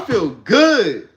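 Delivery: hyped morning affirmation; loud and excited. Shown as a demonstration. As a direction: shout it with full belief. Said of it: "But you be like".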